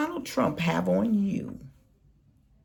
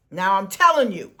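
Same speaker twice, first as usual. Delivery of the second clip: ordinary talk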